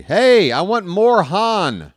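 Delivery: high, drawn-out joking holler; hammed-up high yell; mock movie-goer yell. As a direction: yell it out like a heckler in a cinema. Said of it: "I yelled the same thing".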